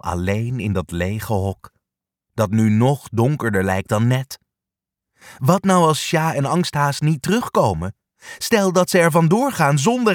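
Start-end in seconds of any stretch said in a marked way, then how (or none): none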